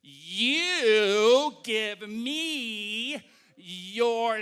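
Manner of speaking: very slow, exaggerated mouthing; pantomimed talk. Miming to Cindy underwater, very slow and exaggerated. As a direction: stretch each word as if miming underwater. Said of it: "I try to pantomime to Cindy".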